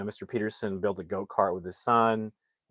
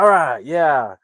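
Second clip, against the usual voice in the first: loud, raised mock enthusiasm; trying to be upbeat; 5 semitones higher, 11 dB louder, slower than usual. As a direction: imitate a dad forcing cheer. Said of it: "upbeat about it".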